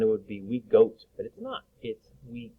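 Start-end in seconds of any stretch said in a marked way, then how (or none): 0.0-1.0 "goat" with a long oh vowel; teacher showing the wrong vowel. Shown as a demonstration. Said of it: "If it were long O sound".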